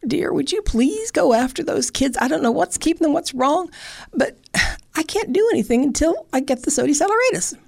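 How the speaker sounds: a flustered grandmother pleading nicely; sweet old grandma. Acted. Grandma asking her husband to fetch the kids, high and fussing. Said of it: "So she said to her husband".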